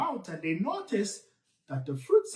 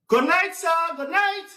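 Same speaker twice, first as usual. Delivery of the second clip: high, loud repeated greeting; louder greeting; 9 semitones higher, 5 dB louder than usual. Acted. Nancy greeting the silent tar baby, higher and louder. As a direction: call out to get an answer.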